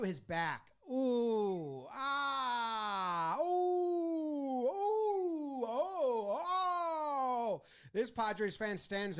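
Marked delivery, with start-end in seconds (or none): 0.9-7.6 rising ooh and oh wails, pitched high; drawn-out sympathetic ooh; pained crowd groans. Pretend. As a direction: wince aloud like a whole stadium. Crowd reacts to hit pitch.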